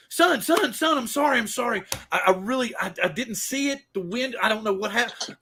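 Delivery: high, stammering flustered trucker; acted distraught pleading, pitched high; panicked apology. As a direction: apologize frantically, tripping over the words.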